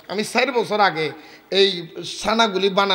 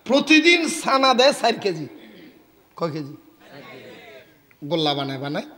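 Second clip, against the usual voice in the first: usual speaking voice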